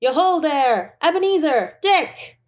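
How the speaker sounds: put-on a light jolly old boss calling his apprentices; jovial calling out. A narrator's reading. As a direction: call out cheerfully, rich and merry. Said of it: "called out in a comfortable, oily, rich, fat, jovial voice".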